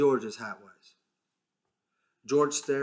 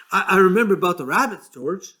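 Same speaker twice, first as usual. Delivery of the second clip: quick, higher, louder, eager; blurting it out happily; eager Lenny; 6 semitones higher, 9 dB louder than usual. A narrator's reading. Lenny remembering the rabbits, eager and fast.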